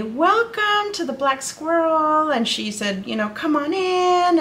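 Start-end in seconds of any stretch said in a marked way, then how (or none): 0.0-2.3 high, drawn-out friendly welcome; super friendly. Retold or imitated. Shopkeeper greeting a visitor, super friendly. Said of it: "the woman there was super friendly". 3.3-4.4 high, slow welcoming call; super friendly. Retold or imitated. Shopkeeper inviting a family inside, super friendly. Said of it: "the woman there was super friendly".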